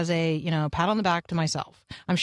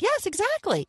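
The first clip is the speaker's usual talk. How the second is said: excited reply; 14 semitones higher than usual. Retold or imitated. Her own cheerful reply, retold.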